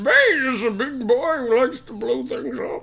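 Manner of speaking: muffled, echoing voice inside helmet; Bane voice. Retold or imitated. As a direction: talk like Bane through his mask. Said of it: "And Bane is just".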